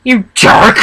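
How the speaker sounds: high, loud outraged yell; shouting. Acted. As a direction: yell it at the jerk. Shouting at the rude stallion.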